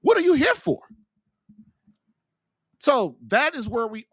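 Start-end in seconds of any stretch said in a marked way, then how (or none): 0.0-0.8 pitched way up, louder, demanding an answer; preacher's rising challenge; raised challenge. Acted. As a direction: throw the question at them hard. Preacher's repeated challenge, much higher.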